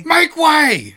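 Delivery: mock shout. Mock guard shouting at a crowd, clear the path. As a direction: yell it like a guard shoving through.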